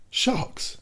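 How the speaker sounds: sharp, breathless high gasp of a word; startled exclamation. A narrator's reading. Narrator alarmed when Nemo asks about sharks, gasping. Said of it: "exclaimed I".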